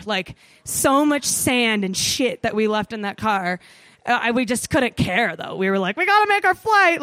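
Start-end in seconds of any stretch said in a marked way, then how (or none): 6.0-7.0 panicked rush. Retold or imitated. Rushing to catch flight.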